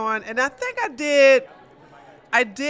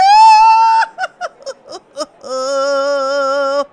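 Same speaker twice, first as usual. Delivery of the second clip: loud laugh; 8 dB louder than usual. Real, not acted. Laughing after her song.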